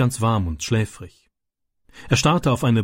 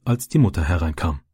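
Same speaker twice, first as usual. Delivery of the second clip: ordinary delivery, nothing marked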